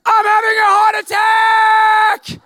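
high, slow, panicked yell; screaming. Retold or imitated. Bursting into the ER, screaming at the nurses. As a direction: scream it out like he's dying. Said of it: "and I'm screaming".

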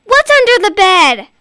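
loud, high, anxious; urgent question. Acted. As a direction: ask urgently. Child urgently asking.

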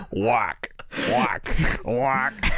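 low giant duck quack. Retold or imitated. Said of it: "three octaves lower".

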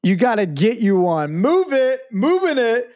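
high, hyped workout-tape pitchman; fake fitness infomercial. Host pitching a banana fitness plan to listeners, hyped infomercial voice. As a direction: sell the exercise tape like a cheesy TV ad.

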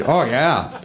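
put-on lightly done Fargo accent. Host echoing an audience member from Wisconsin, light Fargo accent. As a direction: answer with a touch of Fargo. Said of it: "why are you doing a Fargo accent".

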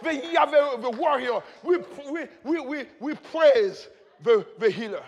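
loud, high declamation of scripture; full-force preaching. Real, not acted. Preacher declaiming psalms and names of God, fervent full-force preaching. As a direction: proclaim praise with mounting fervour.

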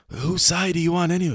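high, irritated hobbit voice; annoyed Merry. Retold or imitated. Merry annoyed at Treebeard, high irritated voice. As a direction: snap it like an exasperated hobbit. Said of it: "Merry ... so annoyed. He's like".

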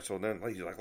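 usual speaking voice

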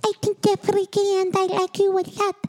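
high-pitched imitation of the singer; squeaky sung-speech impression. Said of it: "I'm good at it".

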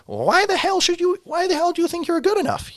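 very high, strained parody voice; hammed-up squeaky indignant lecturing; Jordan Peterson. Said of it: "someone like Jordan Peterson saying".

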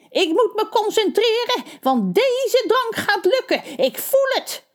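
high, screeching witch. A narrator's reading. The witch screeching at the townsfolk through the door, very high. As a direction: shriek it like a cranky witch.

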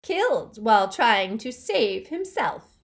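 loud sneering relish; relishing lie. A narrator's reading. Dark lord relishing his lie, loud sneer. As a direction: savour the lie, loud and cruel. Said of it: "there was relish in his voice for the lie".

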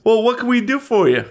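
sneering small-town Southern voice; Southern yokel. Acted. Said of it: "I'm from the South".

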